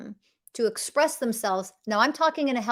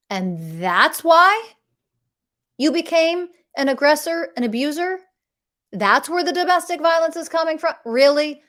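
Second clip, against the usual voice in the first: high, raised-voice indignant questioning; incredulous challenge; 7 semitones higher, 5 dB louder than usual. Real, not acted. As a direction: question it as utterly absurd. Challenges the excuse in disbelief.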